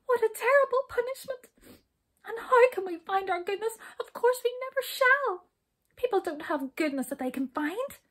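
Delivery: very high, wailing lament; weeping. A narrator's reading. Jump despairing over the impossible punishment, tearful wail. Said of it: "wept Jump".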